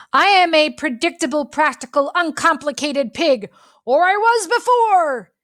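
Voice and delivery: high, loud, rising furious yell. Acted. Pete snapping at Pickles for getting into things. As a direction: let it build into an outburst. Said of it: "he yelled".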